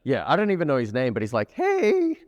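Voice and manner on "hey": very high, squeaky greeting; high-pitched cartoon character. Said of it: "he talks like that".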